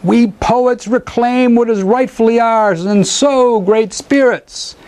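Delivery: high, incantatory declamation; bellowed invocation. A narrator's reading. Poet calling the great spirits to the moon, bellowed. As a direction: declaim it skyward like a bard summoning spirits. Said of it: "he bellowed into the".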